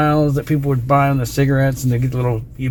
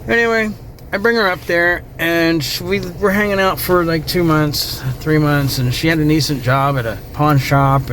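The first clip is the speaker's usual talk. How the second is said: usual speaking voice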